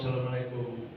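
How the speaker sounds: quiet timid knock-at-the-door greeting; a poor man calling meekly at night; soft beggar greeting. Acted. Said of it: "ada seorang minta-minta".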